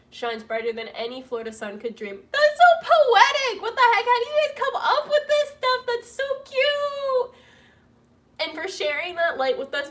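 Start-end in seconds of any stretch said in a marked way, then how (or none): 2.3-7.3 gushing delight. Real, not acted. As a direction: gush loudly, stretching the words out. Gushing over a viewer's message.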